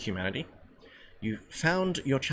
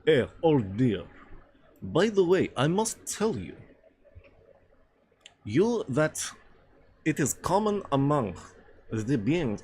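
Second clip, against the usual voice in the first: hammy, halting Russian accent; badly faked Russian, higher pitched in places; 4 semitones higher, slower than usual. Shown as a demonstration. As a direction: try on a stagy Russian accent. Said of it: "How do I do a Russian accent?".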